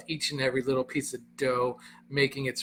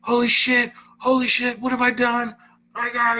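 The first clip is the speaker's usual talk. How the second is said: panicked, much higher and louder; a friend who thinks you're dead, shouting in panic; high, loud panicked yelling; freaking out; 10 semitones higher, 8 dB louder than usual. Retold or imitated. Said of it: "he freaks out".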